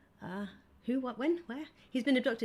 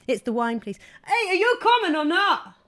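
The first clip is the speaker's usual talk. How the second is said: ordinary talk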